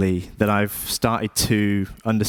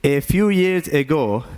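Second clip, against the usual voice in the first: put-on Indian accent, higher-pitched; lilting Indian-accented English; 4 semitones higher than usual. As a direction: speak as an Indian immigrant father would. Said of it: "why I'm even talking like this".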